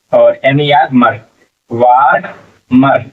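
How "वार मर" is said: वार मर slowly, in two separate drawn-out parts; sounded out. Shown as a demonstration. Teacher builds the word for learners, वार मर sounded out slowly. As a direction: stretch वार, pause, then say मर.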